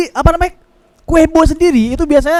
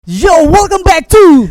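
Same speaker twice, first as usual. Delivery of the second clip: slow, loud drawn-out intro; announcer voice; 5 semitones higher, 6 dB louder, much slower than usual. Acted. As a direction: call it out like a show opener. Segment opener. (suara pembawa acara lambat dan keras)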